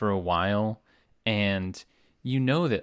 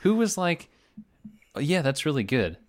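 incredulous; 8 semitones higher than usual. Retold or imitated. Mocking incredulous question.

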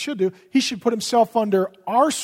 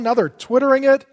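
normal talking voice

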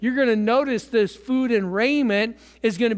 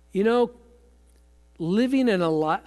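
ordinary delivery

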